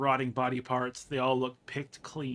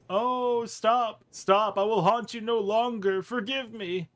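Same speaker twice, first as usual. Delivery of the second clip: high, hammed-up begging; pleading corpse; 7 semitones higher, 4 dB louder, slower than usual. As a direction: wail for mercy in a thrown voice. Beaten corpse begs Marta.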